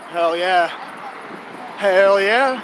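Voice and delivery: loud, pumped-up cheer. Real, not acted. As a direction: shout it like a rallying cry. Pumped up while jogging.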